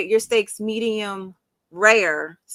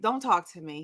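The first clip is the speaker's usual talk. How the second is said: low, softer imitation of a fed-up contestant; annoyed; 5 semitones lower, 5 dB quieter than usual. Contestant refusing the interviewer's question, annoyed. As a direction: shut the questions down, lightly acted. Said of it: "I am annoyed".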